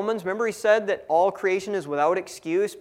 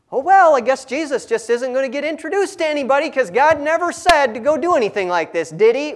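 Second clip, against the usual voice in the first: louder, higher fake-innocent shrug; sarcastic mock innocence; 7 semitones higher, 6 dB louder than usual. Paul needling the church about God's command, loud sarcastic mock innocence. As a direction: play dumb with heavy irony. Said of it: "Paul being a little sarcastic".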